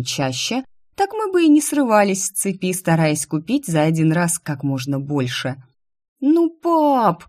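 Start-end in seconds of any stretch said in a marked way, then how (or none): 6.2-7.2 high whiny plea to dad; whining. Acted. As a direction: whine it like a spoiled daughter. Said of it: "заныла Инесса".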